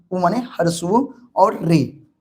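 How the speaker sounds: ordinary talk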